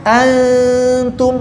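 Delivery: usual speaking voice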